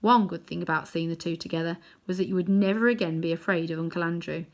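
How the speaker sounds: ordinary delivery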